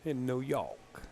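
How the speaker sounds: drawn-out New Yawk; New York accent. Shown as a demonstration. Commentator tagging on his accent joke, New York accent. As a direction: stretch York in broad New York talk. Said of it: "Spelt Y A W K".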